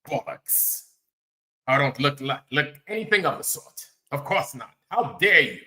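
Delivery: hammed-up affronted British accent; put-on British indignation; mock British accent. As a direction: play an offended Brit, pitch swooping up. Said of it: "you know, you Brits".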